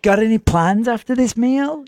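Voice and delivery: put-on friendly waiter voice; tip-seeking server. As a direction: make small talk like a server after a tip. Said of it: "tip culture's bigger. So it's very much like".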